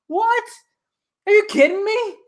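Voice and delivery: high, incredulous outrage; mock outrage. Vlogger reenacting his theater outrage, high incredulous. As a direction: act stunned and offended. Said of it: "I'm like".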